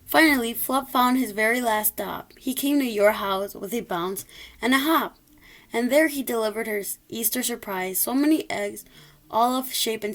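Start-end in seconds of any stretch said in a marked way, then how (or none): none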